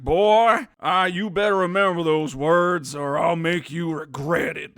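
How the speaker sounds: loud acted warning from a protective uncle; hammed-up threat to a boy; Cid's threat. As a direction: warn him big and loud, easing off at the end. Acts Cid threatening the boy.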